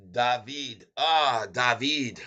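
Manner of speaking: David said dah-VEED, stress on the end; French David. Shown as a demonstration. Streamer to viewer David, using the French reading of his name. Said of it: "that's the French pronunciation".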